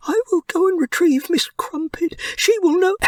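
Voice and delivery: a little mouse distraught over his dying friend; young mouse Pip. A narrator's reading. Pip wants to fetch help.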